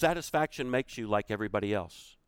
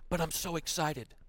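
put-on excited little boy; 6 semitones higher than usual. Little boy quote in story.

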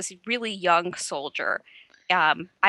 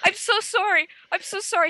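high, flustered, laughing-edged apology; frantic apology; 13 semitones higher than usual. Retold or imitated. To the stranger whose thigh she grabbed, a high flustered apology. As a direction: gush out sorry in a panic. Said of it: "And I'm like".

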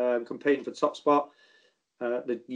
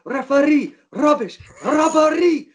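much higher, louder hammed-up yell at the ref; mock touchline shout; 12 semitones higher, 7 dB louder, slower than usual. Manager screaming at the referee from the touchline, much higher and louder. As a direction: bellow at the referee like a raging manager. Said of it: "And he went".

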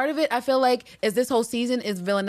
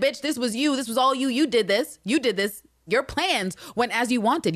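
mock Villanelle accusing; 6 semitones higher than usual. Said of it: "Like Carolyn said".

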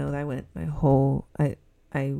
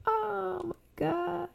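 laughing; 4 dB quieter, much slower than usual. Real, not acted. Laughing fit while talking.

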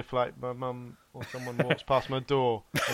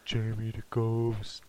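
ghost voice; 5 semitones lower, 5 dB quieter, slower than usual. Pretend. Speaks as ghost.

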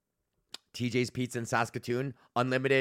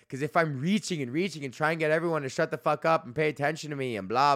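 usual speaking voice

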